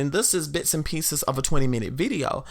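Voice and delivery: ordinary talk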